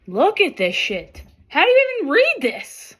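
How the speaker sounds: high, loud baffled question; exasperated disbelief. Real, not acted. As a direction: throw up your hands at the cards. Overwhelmed by cards.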